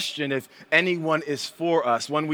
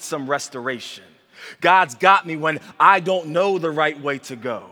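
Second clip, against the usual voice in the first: louder, rising preaching litany; preaching at full force; 2 semitones higher, 6 dB louder than usual. Acted. Pastor rallies the congregation with a loud, rising litany. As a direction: build each line with fervour.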